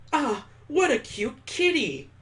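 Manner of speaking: a burst of giddy delight; excited squeal. Acted. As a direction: coo over the cat. Mallory sees a cat.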